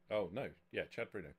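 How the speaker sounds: usual speaking voice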